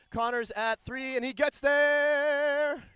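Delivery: high, loud, stretched play-call; excited call. Acted. Commentator calling the runner home, excited. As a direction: sell the run like a big moment.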